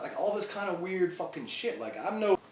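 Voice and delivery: normal talking voice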